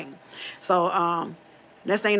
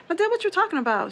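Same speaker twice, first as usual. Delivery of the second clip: baffled question; 12 semitones higher, faster than usual. Retold or imitated. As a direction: pitch jumps high in disbelief. Confused reply to grandmother's claim.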